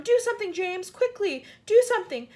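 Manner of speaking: high, loud, urgent pleading; frantic cry. A narrator's reading. Earthworm begging James to act, frantic cry. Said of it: "cried the earthworm".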